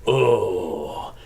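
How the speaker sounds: lightly voiced low Muppet prawn; a hint of the Muppets' prawn, low; Pepe Le Prawn. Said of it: "doing a Pepe Le Prawn from the Muppets".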